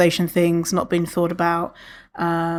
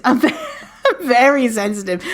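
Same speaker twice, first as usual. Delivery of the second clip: laughing; 4 semitones higher than usual. Real, not acted. Laughing at own sensitivity.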